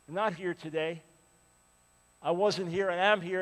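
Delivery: normal talking voice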